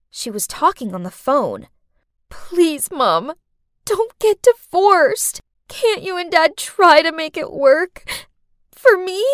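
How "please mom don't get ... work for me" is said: pleading. Acted. Crying girl on phone.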